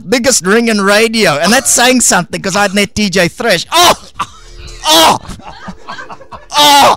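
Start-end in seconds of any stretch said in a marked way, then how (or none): 3.7-7.0 big hammed-up exclamations; mock shouted oh. Puppet reacts to ring joke.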